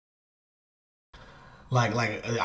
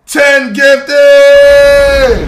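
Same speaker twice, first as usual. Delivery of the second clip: much higher, louder celebrating shout; excited shout; 12 semitones higher, 12 dB louder, much slower than usual. Real, not acted. Streamer celebrating ten gifted subs, shouting. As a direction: celebrate the gift at full voice.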